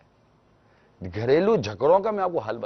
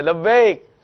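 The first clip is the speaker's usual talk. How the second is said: higher, louder "لبیک" called from the next room; eager reply; 5 semitones higher, 8 dB louder, much slower than usual. Retold or imitated. Wife answering her husband's call from the kitchen, loud and eager. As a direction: answer brightly at once. ادھر سے آواز آئے.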